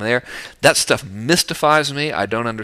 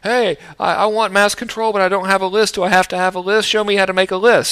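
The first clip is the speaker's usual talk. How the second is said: mock pleading, higher and a bit louder; pleading viewers; 7 semitones higher, 4 dB louder than usual. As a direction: play the eager subscriber begging for help. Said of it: "people saying".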